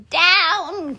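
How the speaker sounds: high, drawn-out call; witch's cry. A narrator's reading. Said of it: "cried the witch".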